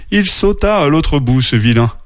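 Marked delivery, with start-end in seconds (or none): none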